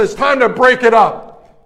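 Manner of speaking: loud, high, slowed command; acted-out authoritative yell; shouted intervention. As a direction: call it out over a brawl. Retold stepping into a fight.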